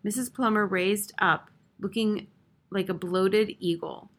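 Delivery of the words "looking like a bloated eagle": plain reading voice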